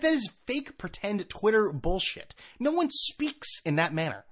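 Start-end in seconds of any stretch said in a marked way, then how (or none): none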